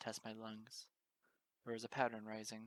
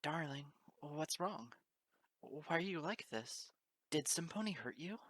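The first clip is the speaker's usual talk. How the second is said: higher, gentle worried asking; tender concern; 7 semitones higher, slower than usual. Acted. Mare asking the shaking stranger what's wrong, tender and higher.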